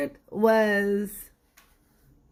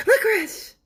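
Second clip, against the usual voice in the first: much higher, louder shouted blurt; blurting sweet tooth; 13 semitones higher, 5 dB louder than usual. Acted. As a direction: blurt it out from the back row.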